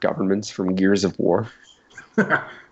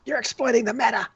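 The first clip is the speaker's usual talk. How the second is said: fast, high complaining nerd; whiny nerd voice; 11 semitones higher, faster than usual. Acted. Acting a player at the table.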